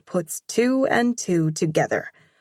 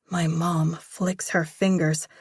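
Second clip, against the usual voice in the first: ordinary delivery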